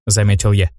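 plain reading voice

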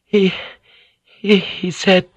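halting, breathless stammer; a frightened stammer; stumbling words. A narrator's reading. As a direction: she can barely get the words out. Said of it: "Her words stumbled".

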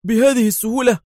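high, loud disbelieving question; surprised. A narrator's reading. Yael asks Adham in disbelief, high and surprised. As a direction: raise the eyebrows in the voice, amazed. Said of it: "ارتفع حاجب يائيل في دهشة".

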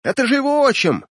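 high, startled cry of recognition; excited exclamation. A narrator's reading. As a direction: blurt it out as you spot him. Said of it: "воскликнул кто-то из команды".

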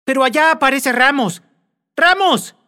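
high, loud shout across the dark; frantic high shout; calling out. A narrator's reading. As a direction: yell to him from far away.

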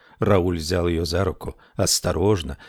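plain reading voice

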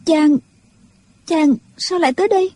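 startled lady, voice meant to tremble; emotional young lady. A narrator's reading. Triệu Mẫn asks why he came, moved. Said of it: "Giọng nàng run run, chứng tỏ trong lòng vô cùng xúc động".

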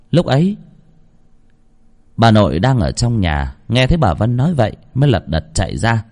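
ordinary delivery, nothing marked

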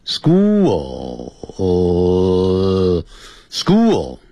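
SCHOOL drawn out extremely slowly, holding the dark L; model pronunciation. Shown as a demonstration. Teacher showing tongue placement, SCHOOL with long held L. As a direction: stretch the end of "school", tongue tip up on L. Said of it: "但是舌尖抵起来".

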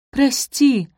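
loud, high blurted sorry; blurted apology. Acted. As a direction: blurt it out at once. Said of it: "выпалила Софи".

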